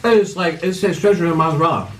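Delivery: talking through a mouthful of food; words round a big bite; mouth full. Real, not acted. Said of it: "do you have to talk with your mouth full".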